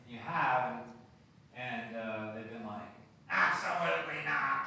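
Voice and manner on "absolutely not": loud, raised shout; mock outraged rejection; emphatic refusal. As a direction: shout down the offer outright. Acted rude refusal of prayer.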